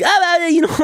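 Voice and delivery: high, slurred babble; imitated gibberish. Mimicking the alley woman, high gibberish. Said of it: "gibberish and be like".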